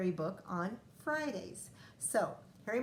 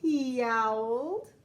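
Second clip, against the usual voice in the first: dramatic storytelling; 12 semitones higher, 8 dB louder, much slower than usual. A narrator's reading. Cat stuck up in tree.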